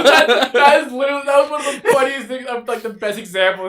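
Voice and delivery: very high and loud, laughing while talking; real laughter. Real, not acted. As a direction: gasp the words out through laughter. Laughing after a joke.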